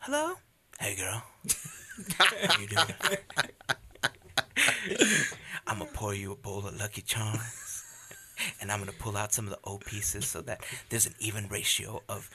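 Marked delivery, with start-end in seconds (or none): 0.0-9.6 lightly crooned smooth-singer voice; a hint of a smooth R&B flirt. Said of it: "He's like".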